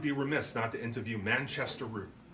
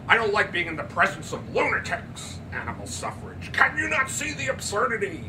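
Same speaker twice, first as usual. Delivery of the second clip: higher, louder outburst; agitated father; 8 semitones higher, 6 dB louder than usual. Acted. Andrew to Almy, agitated and scornful. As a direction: rant at the lunacy.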